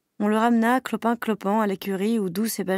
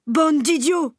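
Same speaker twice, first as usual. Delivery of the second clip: higher, louder angry bray; braying insult; 7 semitones higher, 6 dB louder, slower than usual. Acted. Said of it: "se mit à braire Benjamin". (âne furieux qui brait contre les animaux)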